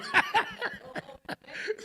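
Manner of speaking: loud high laugh. Real, not acted. Laughing at his own story, loud and high. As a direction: burst of high-pitched laughter.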